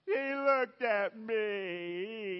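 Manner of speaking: put-on drawn-out childish whine; tattling child. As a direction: drag it out like a kid complaining. Child tattling in acted squabble.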